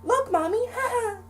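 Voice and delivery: put-on very high squeaky child showing off; little boy holding out his arm to mom, very high; proud little kid. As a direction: show off the wound like a giddy kid. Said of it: "just like".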